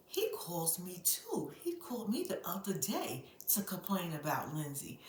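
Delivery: put-on a lightly hushed tattling kid; hushed confession. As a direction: lean in and confess quietly. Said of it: "so Gary then goes".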